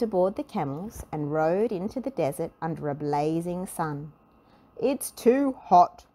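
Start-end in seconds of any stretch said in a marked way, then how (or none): 4.8-6.1 slow, loud, higher moaning; a grouch whining about the heat; grumpy complaint. A narrator's reading. Mister Grumpy complains about the heat, slow loud moan. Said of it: "complained Mister Grumpy".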